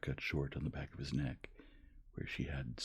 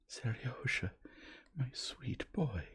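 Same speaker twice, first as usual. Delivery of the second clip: higher, slow, half-whispered and choked; choking with emotion; 9 semitones higher, slower than usual. A narrator's reading. Mother embracing her long-lost son, choked up. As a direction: let the tenderness choke the words.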